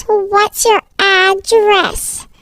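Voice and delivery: very slow, word-by-word question; insistent repeat. Acted. Stranger presses a silent child for an address, very slow and insistent. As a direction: draw each word right out, pressing for an answer.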